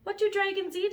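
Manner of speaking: put-on high, bright boy voice; wide-eyed little boy; curious boy voice. Elmer voiced in story.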